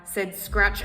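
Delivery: ordinary delivery, nothing marked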